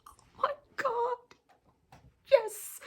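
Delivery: high, slow, swooning oh my God; swooning squeal. Retold or imitated. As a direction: gush like a fan melting at the page. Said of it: "I'm like".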